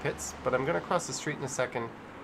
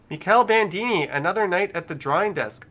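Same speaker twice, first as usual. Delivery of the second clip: loud, raised announcement; donor shout-out; 6 semitones higher, 9 dB louder than usual. Real, not acted. Streamer announces a viewer's message, loud shout-out. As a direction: call out the donor, voice lifted.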